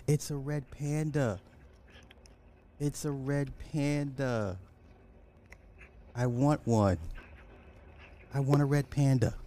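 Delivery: high-pitched delighted coo; cutesy cooing. Pretend. Streamer admiring a red panda for viewers, cooing. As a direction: coo it like baby talk.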